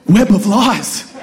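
very slow, mock dramatic. Pastor realizing the driver lied, mock dramatic. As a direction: savor each word like a movie twist.

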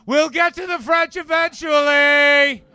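loud, high, slow mock drawl; shouted lazy promise nobody means; lazy dismissive Canadians. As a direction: stretch it out, loud and careless. Mocking the rest of Canada.

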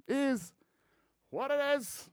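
mock squeaky voice repeating the phrase; squeaky high echo. Shown as a demonstration. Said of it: "It fucking is what it is".

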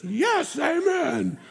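high, shouted yes, amen; excited congregation amen. Retold or imitated. Preacher mimicking his excited congregation, high shouted amen. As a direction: cheer it like an eager churchgoer. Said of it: "some of you are excited about that one".

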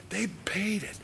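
"They paid it" whispered, pitched high, falling. Acted. Priests to each other over blood money, whispered. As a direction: breathe it out as a secret.

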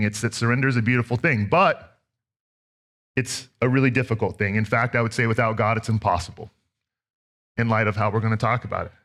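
ordinary talk

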